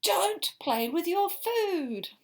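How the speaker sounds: slow, high, loud scolding; stern scold. Acted. Mother reading the book, slowly scolding David. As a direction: draw out the reprimand.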